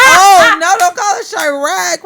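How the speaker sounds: high, loud, laughing mock whine; hammed-up mock of people saying Chirac; mocking imitation. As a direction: imitate the outsiders who correct you. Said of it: "y'all love to say".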